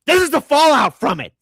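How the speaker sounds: loud angry rant. Real, not acted. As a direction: shout it at your critics. Ranting at critics.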